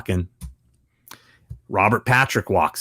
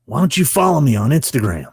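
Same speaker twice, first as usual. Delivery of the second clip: raised actor impression; imitated tough actor, pitched up; Robert Patrick; 5 semitones higher than usual. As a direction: make the request as the actor. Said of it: "And he goes".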